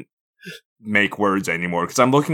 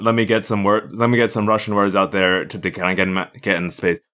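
ordinary delivery

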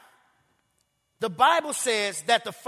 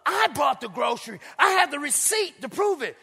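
exasperated shout; 5 semitones higher than usual. Retold or imitated. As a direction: wave the receipt in her face. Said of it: "he said".

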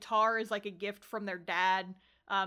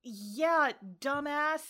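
irritated; 4 semitones higher than usual. Retold or imitated. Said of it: "He's so irritated".

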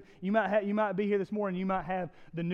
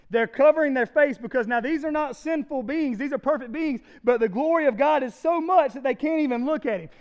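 louder, higher preaching, rising in intensity; full-force preaching; 7 semitones higher, 9 dB louder than usual. Acted. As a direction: preach with mounting fire to the congregation.

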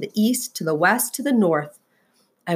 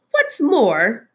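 snapped; 6 semitones higher, 3 dB louder than usual. A narrator's reading. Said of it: "snapped the Lorax".

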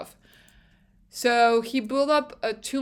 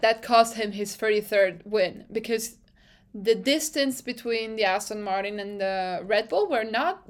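ordinary talk

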